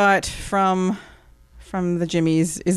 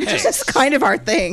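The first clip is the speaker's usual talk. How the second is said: higher, laughing repeat; 6 semitones higher than usual. Shown as a demonstration. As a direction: say it again through a laugh. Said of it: "repeated louder with laugh".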